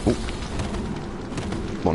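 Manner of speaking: usual speaking voice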